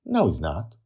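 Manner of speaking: plain reading voice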